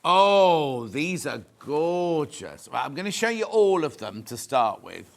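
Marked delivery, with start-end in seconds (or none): 0.0-2.7 loud drawn-out oh, then a slow gorgeous; delighted. Real, not acted. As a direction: swoon over the fabric. Admiring new fabric.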